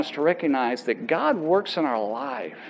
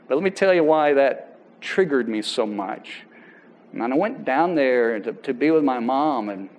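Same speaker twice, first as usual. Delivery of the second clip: usual speaking voice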